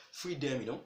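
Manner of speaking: ordinary delivery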